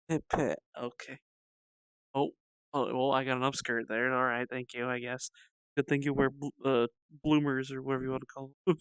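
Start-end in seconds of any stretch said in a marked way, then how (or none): none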